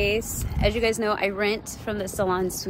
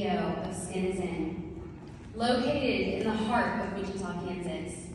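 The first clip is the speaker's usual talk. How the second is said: formal speech; 2 semitones lower, 9 dB quieter than usual. Acted. Delivers pitch competition speech.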